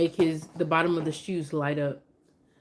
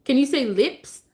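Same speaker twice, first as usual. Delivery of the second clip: high, louder singsong question; coaxing teacher; 7 semitones higher, 5 dB louder than usual. Shown as a demonstration. Mom coaxing her baby to say lips. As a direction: coax him gently.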